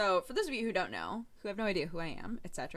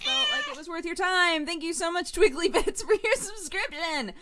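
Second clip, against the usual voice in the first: excited thanks; 10 semitones higher, 5 dB louder than usual. Real, not acted. Streamer thanking a subscriber.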